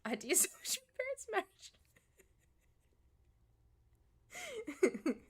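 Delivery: high giggling through the joke; laughing at own dark joke. Real, not acted. Laughing joke about parents.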